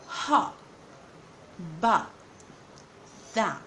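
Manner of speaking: "ha", "ba", "tha" cut off with a glottal catch, no T; glottal stop for T. Shown as a demonstration. Teacher showing students hot, but, that ending in a glottal stop. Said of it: "replace it with, uh, what we call hamza".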